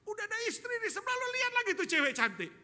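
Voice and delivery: very high, loud, rapid-fire tirade; acted furious scolding. Wife catching husband eyeing another woman, screeched scolding. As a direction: explode into a hammed-up screech of reproach.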